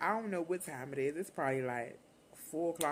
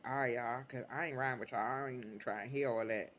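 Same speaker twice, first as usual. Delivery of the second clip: lower, dismissive mutter; 5 semitones lower than usual. Retold or imitated. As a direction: brush her off, low. Teen dismissing mother low.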